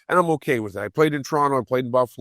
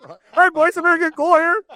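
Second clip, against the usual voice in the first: very high, loud, cartoonish farewell; squeaky mock cheer to the boys; hammed-up self quote; 5 dB louder than usual. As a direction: play yourself as a goofy excited rookie. Hams up his own exit line.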